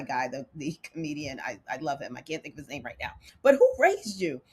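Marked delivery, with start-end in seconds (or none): 3.4-4.4 light imitation of a comedian's higher catchphrase. A light comedian imitation asking who raised you. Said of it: "In the famous voice of".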